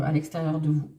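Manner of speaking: normal talking voice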